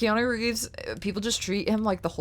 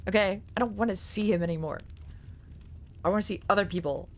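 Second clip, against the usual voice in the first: usual speaking voice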